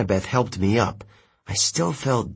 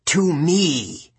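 summoning call in a ghostly villain's voice, higher; crying out; 5 semitones higher than usual. A narrator's reading. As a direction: call out a command with restrained force. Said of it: "Minos cried".

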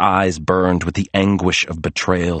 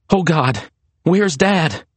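anxious thought; 9 semitones higher, slower than usual. A narrator's reading. Frantic search for endangered father.

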